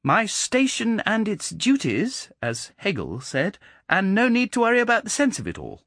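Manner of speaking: put-on high, ironic child's voice; a clever boy quoting Hegel slyly; mocking precocious boy. A narrator's reading. Boy John speaks.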